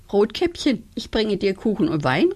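ordinary delivery

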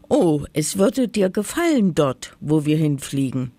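ordinary delivery

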